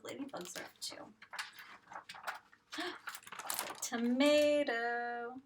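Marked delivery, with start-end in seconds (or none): 2.7-3.8 gasp. Acted. Gasp before revealing answer. 3.9-5.4 drawn-out reveal. Acted.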